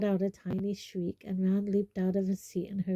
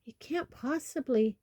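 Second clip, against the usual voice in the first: higher-pitched shocked protest; 5 semitones higher than usual. A narrator's reading. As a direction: object in disbelief. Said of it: "sounding shocked".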